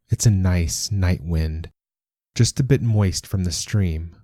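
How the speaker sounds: ordinary delivery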